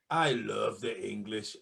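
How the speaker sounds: slow, low attempt at a Scottish accent. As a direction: say it like a gruff Scotsman. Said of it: "the attempt at the Scottish accent".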